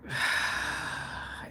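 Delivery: long, soft sigh of dismay; exasperated sigh. Real, not acted. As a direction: breathe out, lost for words. Dismay at bad accents.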